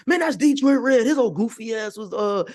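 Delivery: high, mocking imitation of a scoffing onlooker; mocking gossip voice. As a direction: sneer it like a gossiping neighbor. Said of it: "people would be like".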